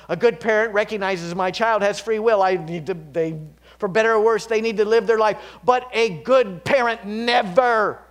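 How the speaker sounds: loud, high impassioned preaching; fiery pulpit declaration; preaching at full force. Real, not acted. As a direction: proclaim it to the back row. Sermon climax on good parent.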